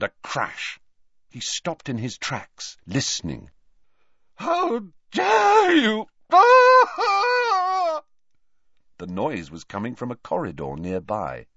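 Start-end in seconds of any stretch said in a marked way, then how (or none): 4.3-6.1 high, slow, shrieking outrage; outraged shriek. Acted. Trelawney screams in corridor. 6.3-8.0 loud, high cry of alarm; a shrill wordless shriek; high scream. Acted. Scream heard down the corridor.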